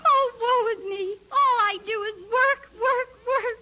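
hammed-up wailing woe; overdone tragic complaint; melodramatic lament. Princess laments her drudgery.

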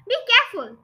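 sudden high, loud warning; narrator's brief shriek. A narrator's reading. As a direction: cry out in alarm. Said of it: "shrieked the mother".

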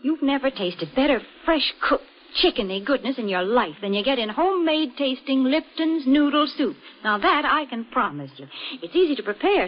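ordinary talk

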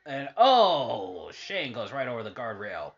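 sudden loud call of a big spot; wrestling commentator. Acted. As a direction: burst out as Shane dives over the rail. Calling the match on TV.

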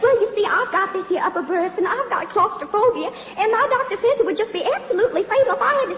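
flutter and gush with fake distress to win him over; a belle's breathless plea, climbing in pitch; gushing southern belle plea. Belle pleads for his drawing room.

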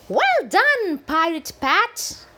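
high, loud bright praise; cheerful praise. Acted. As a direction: cheer him on gleefully. Characters cheer Pat.

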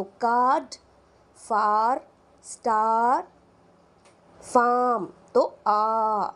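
कार्ड, फार, स्टार with a long open aa; teacher models the long vowel; long aa demo. Shown as a demonstration. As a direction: stretch the vowel. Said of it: "अगर मैं इसको थोड़ा बढ़ा दूं".

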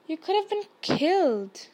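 mildly aghast outburst; exclaiming. A narrator's reading. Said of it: "Hermione exclaimed".

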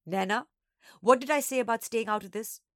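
mildly angry reprimand; annoyed older sister. A narrator's reading. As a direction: scold a pesky little sister. Older sister scolds the younger.

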